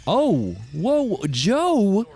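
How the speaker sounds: high, loud startled cry of his name; surprised shout. Real, not acted. Streamer startled by Joe's raid, shouting in surprise.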